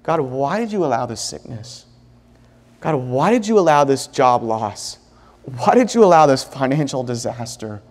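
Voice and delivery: low, hushed questioning of God; soft, low acted reproach; bitter questioning. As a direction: voice a subdued, wounded complaint to the Lord. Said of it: "the bitterness that's in our hearts".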